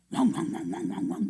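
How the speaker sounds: breathy, toneless wang-wang babble mimicking a roar; imitation of a wild prayer racket; mock babbling roar. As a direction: rattle it off like a noisy foreign prayer. Mimicking foreign preacher's roaring prayer.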